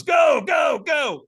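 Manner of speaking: loud, very high cries to launch someone; urgent yelling. Retold or imitated. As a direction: act out a trainer yelling them onward. Trainer yells him onto the coals.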